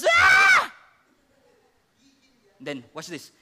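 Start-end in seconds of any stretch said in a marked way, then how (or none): 0.0-0.7 much higher, very loud outraged scream; furious scream. Acted. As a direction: shriek in fury. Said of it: "Sabi ni Cephas".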